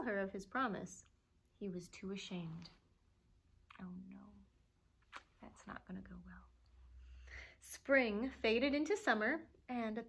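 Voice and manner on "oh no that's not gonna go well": hushed worried aside. Pretend.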